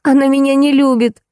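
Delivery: high-pitched petulant complaint; irritated. A narrator's reading. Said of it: "раздражённый Зайкин". (обиженно-раздражённо, высоким голосом)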